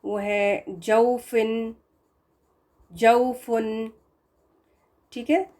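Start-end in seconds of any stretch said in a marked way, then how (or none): 0.0-3.9 जौफुन drawn out slowly, au clear; slow model word. Shown as a demonstration. Teacher slowly models जौफुन for students. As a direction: stretch जौफुन slowly syllable by syllable. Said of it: "example इन्होंने दी हुई है".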